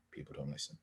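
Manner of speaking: breathy whispered aside. Real, not acted. Unvoiced aside after giving advice.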